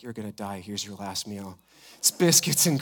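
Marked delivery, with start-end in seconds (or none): 0.0-1.6 low, soft, deadpan aside; hushed mock pronouncement. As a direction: imitate quietly breaking grim news, jokingly. Jokingly voices a last-meal offer.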